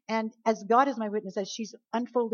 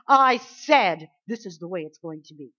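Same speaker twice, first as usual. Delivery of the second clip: loud, firm command; demonstrating bold faith; bold declaration; 11 dB louder than usual. Shown as a demonstration. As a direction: declare it with authority. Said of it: "hands on your hips ... you say".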